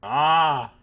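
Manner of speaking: loud gruff grunt; mock Arnold grunt. Grunting to test an Arnold voice. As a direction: bark it like a bodybuilder.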